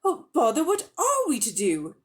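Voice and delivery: high, troubled exclamation; fretting boy. A narrator's reading. Diggory torn about leaving the witch, high and fretful. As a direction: fret over what to do. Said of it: "Diggory said".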